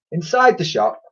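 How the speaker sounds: ordinary delivery, nothing marked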